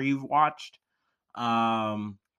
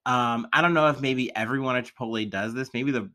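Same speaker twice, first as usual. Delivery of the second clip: ordinary talk, nothing marked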